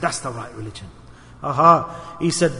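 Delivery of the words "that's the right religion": "That's the right religion" said much lower and softer; hushed repeat. Acted. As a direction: let it sink in quietly. Repeated softly as the conclusion.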